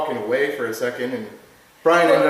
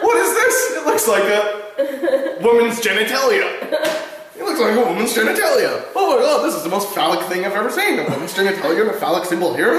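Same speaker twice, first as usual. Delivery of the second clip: giddy outburst; 5 semitones higher than usual. Retold or imitated. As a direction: imitate a stoned friend blurting in amazement. Acting out Brian's giddy outburst.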